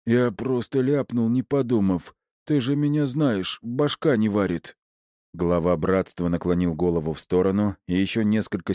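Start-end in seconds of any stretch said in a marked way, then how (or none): 0.1-4.8 higher-pitched, pleading henchman; scared underling explaining himself, pitched up; hoarse croak. A narrator's reading. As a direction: lightly croak out an excuse to the boss. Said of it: "прохрипел он".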